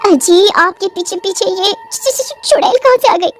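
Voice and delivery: wife scared at seeing the witch; frightened stammer. Acted. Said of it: "बंसी की पत्नी डर जाती है". (डर से हकलाती हुई पत्नी)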